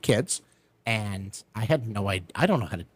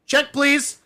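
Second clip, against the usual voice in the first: high, raised call for the bill; calling the waiter; 14 semitones higher, 5 dB louder than usual. Retold or imitated. Man fleeing a failed date, calling the waiter. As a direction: call out brightly to escape the table.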